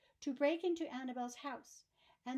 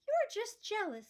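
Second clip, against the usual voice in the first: lightly voiced girl snapping back, higher; a touch of a high retort; 8 semitones higher, 4 dB louder than usual. Annabel retorting to teasing Nate, higher and sharper. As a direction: tease him right back.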